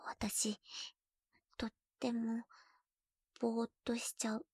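soft breathy murmur; soft dazed whisper. Acted. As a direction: sound melted and dazed. Dazed whisper under him.

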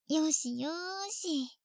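slow, soft there-there; soothing. Acted. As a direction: pat his head with your voice, slowly. Soothing brother, head patting.

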